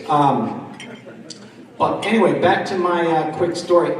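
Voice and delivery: ordinary delivery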